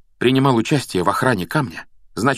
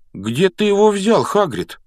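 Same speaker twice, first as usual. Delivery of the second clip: put-on high, louder boyish question; curious boy; 11 semitones higher, 4 dB louder than usual. A narrator's reading. Ron asks about the egg, high and curious. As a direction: ask eagerly in a high voice. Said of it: "поинтересовался Рон".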